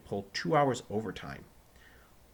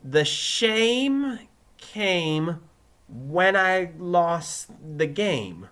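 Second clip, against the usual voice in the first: very slow, loud, high recitation; focused attempt; 8 semitones higher, 8 dB louder, much slower than usual. A narrator's reading. Pupil trying hard for his teacher, focused and very slow. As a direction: stretch each word out with great care. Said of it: "Joey focused".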